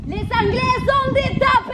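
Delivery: very high, loud, slow bellowed insult; furious shout. Acted. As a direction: bellow it to the whole room.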